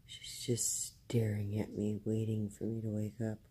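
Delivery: low, soft, half-whispered murmur; hushed morning voice. Real, not acted. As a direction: speak low and softly, just after waking. Just woken, watched by pet.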